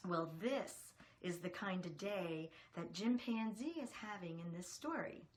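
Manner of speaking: usual speaking voice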